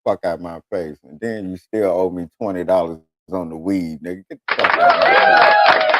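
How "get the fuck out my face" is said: high, loud screeching brush-off; hammed-up high-pitched shout; mock yell. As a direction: screech him out of your face. Shooing off a Bible preacher.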